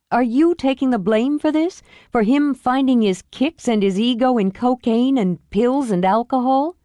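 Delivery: sharp, angry challenge; indignant sister; a whip of anger. Acted. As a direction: call her out on the blame. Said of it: "A whip of anger in her voice".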